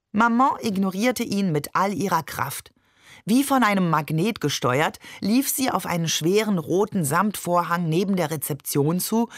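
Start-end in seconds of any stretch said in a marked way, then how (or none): none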